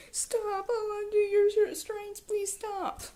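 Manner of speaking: high, panicked pleading; scared guard. Retold or imitated. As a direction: beg in a high, frightened voice. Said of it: "Worst guard ever".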